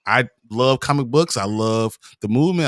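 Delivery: ordinary delivery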